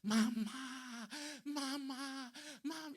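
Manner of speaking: very high imitation of a wailing boy; child crying for mother. Boy throwing himself on his fallen mother, wailing. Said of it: "vi que el niño se lanzó".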